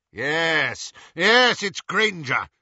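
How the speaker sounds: high, loud eager confirmation; cried out. A narrator's reading. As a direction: exclaim it in triumph. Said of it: "cried Lucius".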